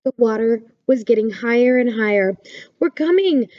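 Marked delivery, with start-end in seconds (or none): 2.8-3.5 high, carrying call. A narrator's reading. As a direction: call ahead to reassure from afar. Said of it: "called Little Hedgehog".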